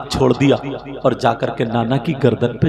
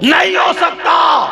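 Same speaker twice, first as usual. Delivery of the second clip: a high, loud cry of no; shouted refusal; 6 dB louder, slower than usual. Acted. Preacher crying out the Prophet's refusal to the crowd, loud. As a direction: cry it out to the whole gathering.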